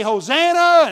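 high, loud call from one side of a crowd; crowd shouting. Retold or imitated. Preacher acts the crowd on one side, shouting. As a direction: shout it across to the other side. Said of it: "they would be shouting back and forth".

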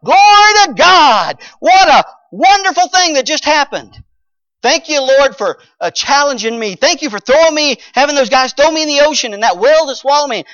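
high, loud, jubilant thanks to God; joyful shout of praise. Acted. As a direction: shout it like a man just spared. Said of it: "He ought to be going".

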